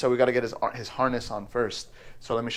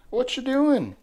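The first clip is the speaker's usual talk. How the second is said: much higher cooing question; 12 semitones higher, slower than usual. Real, not acted. Owner asking the parrot what it is doing, high baby talk. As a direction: ask the bird sweetly.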